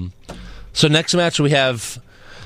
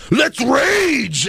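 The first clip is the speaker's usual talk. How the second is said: mock battle cry; 11 semitones higher than usual. Retelling Reigns's cheesy backstage line as a mock yell. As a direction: ham up a cheesy war cry. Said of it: "It was so bad the way he did it".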